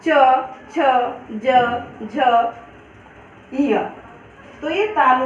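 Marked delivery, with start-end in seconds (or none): 0.1-3.8 च, छ, ज, झ, य each said with the tongue on the palate, then a pause; teacher showing the palatal letters; letter demonstration. Shown as a demonstration. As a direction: sound out च छ ज झ य one by one. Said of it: "जैसे".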